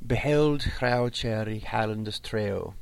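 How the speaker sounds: slow recitation with clear H's in "Beheld hraucheri hallendes treo"; audible H sounds. Shown as a demonstration. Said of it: "You can really hear the H's".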